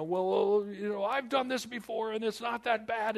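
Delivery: high, loud mock bravado; know-it-all disciple. Preacher voices a disciple shrugging off the storm, loud and high. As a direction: brush off the danger like a veteran. One disciple calming the others.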